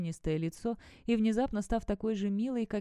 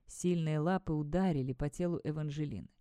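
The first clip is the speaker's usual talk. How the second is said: ordinary delivery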